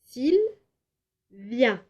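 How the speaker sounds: Sylvia split into two clapped beats; syllables tapped out. Shown as a demonstration. Teacher showing children how Sylvia splits into two. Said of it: "ça donne".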